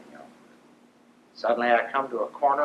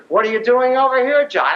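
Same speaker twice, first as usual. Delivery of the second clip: higher, louder amazed question; astonished; 10 semitones higher, 7 dB louder than usual. Retold or imitated. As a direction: ask it in happy disbelief.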